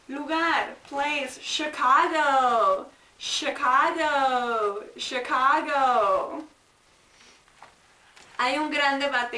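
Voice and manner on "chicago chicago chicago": Chicago slow and loud, sh at the start; slow loud drill. Shown as a demonstration. Teacher to class, modeling Chicago with sh. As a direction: boom out Chicago slowly with sh. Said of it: "teacher drilling Chicago loudly, slowly".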